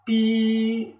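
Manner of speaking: high, sustained imitation train whistle; long toot like a train. Shown as a demonstration. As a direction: hold "pi" on one high, level pitch. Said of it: "este sonido alto y mantenido".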